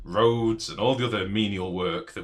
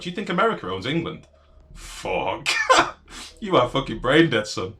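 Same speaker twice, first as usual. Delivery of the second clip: incredulous angry outburst; 5 semitones higher, 6 dB louder than usual. Real, not acted. Mocking chatter's claim about America.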